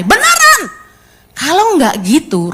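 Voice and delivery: normal talking voice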